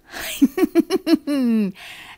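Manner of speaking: high, loud evil imp laugh. Acted. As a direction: cackle like a gloating imp. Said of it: "laughs the evil laugh".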